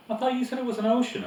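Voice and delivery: put-on the boy protesting to Letty; young boy. A narrator's reading. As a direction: voice the boy, high and quick. Boy voiced in story.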